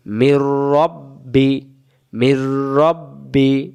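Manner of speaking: slow, chanted মির রব্বি with doubled র; slow recitation. Shown as a demonstration. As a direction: recite the tashdid slowly. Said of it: "তাসদিদ হয়ে গেল মির".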